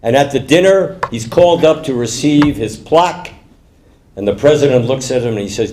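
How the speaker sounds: ordinary delivery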